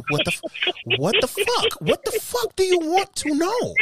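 high-pitched incredulous yelp, drawn from mock outrage; squeaky exasperation at a nosy question; exasperated outburst. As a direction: act fed up, pitch shooting way up. Acted exasperated answer to nosy partner.

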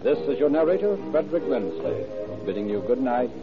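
ordinary talk

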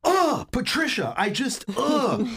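high, slow exasperated groaning; Matt King. Acted. Imitating a frustrated friend, slow high exasperation. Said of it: "this is Matt frustrated".

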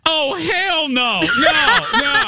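very high, drawn-out refusal, half laughing; hammed-up indignant no; emphatic refusal. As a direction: squeal it in mock horror. Refusing to give up the masseur.

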